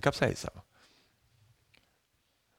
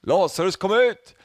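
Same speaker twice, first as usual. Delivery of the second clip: higher, much louder shout of kom ut; loud call; 9 semitones higher, 6 dB louder than usual. Retold or imitated. Preacher voicing Jesus at the tomb, loud shout. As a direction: shout into the open grave. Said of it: "ropar han med hög röst".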